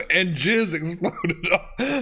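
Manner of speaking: reading through bursts of laughter; laughing while reading. Real, not acted. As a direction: try to read but keep cracking up.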